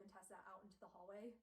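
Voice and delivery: usual speaking voice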